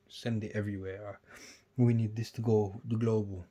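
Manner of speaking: ordinary talk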